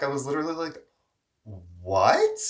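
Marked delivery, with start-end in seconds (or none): 1.4-2.4 shocked what. Retold or imitated. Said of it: "like, in shock".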